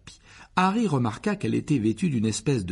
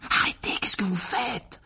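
high, breathless whisper; urgent whisper; 11 semitones higher, 3 dB louder than usual. Acted. Harry stopping Dobby, urgent whisper. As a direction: hiss to stop him without being heard. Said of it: "chuchota Harry".

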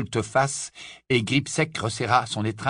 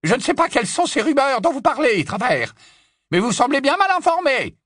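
haughty witch voice; 6 dB louder than usual. Acted. Hermione as Bellatrix.

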